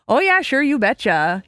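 hammed-up Minnesota accent. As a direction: play up a Minnesota twang. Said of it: "That's obviously Minnesota and Wisconsin".

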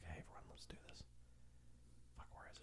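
hushed unvoiced whisper. Real, not acted. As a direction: whisper it so nobody hears. Sneaking onto the call.

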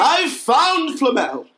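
high, slow triumphant cry; overexcited shout. Acted. Harry revealing Flamel to Ron and Hermione, hammed-up yell. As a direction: draw out the big reveal. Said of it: "I don't think that's how he announced it".

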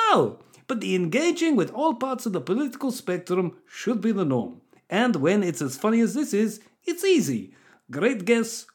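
put-on Russian voice with swooping high pitch; Russian bot accent. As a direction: read it as a hammed-up Russian bot. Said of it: "that's gotta be Russian bot".

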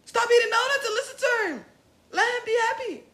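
passionate plea. Real, not acted. Urging the listener.

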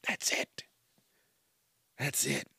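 soft unvoiced whisper. Real, not acted. Whispering that's all it takes. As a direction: breathe it out under the breath. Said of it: "whispered aside".

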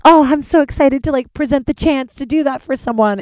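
high, loud over-eager squeal; hammed-up thrilled voice; mock excited gush. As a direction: gush with exaggerated enthusiasm. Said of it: "I'm like".

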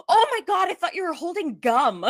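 high, startled apologetic voice; a clerk suddenly realizing her mistake; flustered cashier. Retold or imitated.

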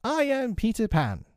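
put-on very high boyish proclamation; triumphant cry. A narrator's reading. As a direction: announce yourself in a high, proud boy's voice. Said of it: "he cried".

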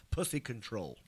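soft, low murmur; sweet nothings. Real, not acted. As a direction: murmur it close into the mic. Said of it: "whispering sweet nothings to me".

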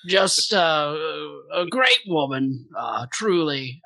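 halting mock British eulogy; solemn British accent with hesitant pauses. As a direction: pay tribute as a put-on Brit. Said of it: "talking like a Brit".

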